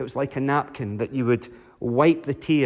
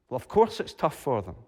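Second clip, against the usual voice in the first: usual speaking voice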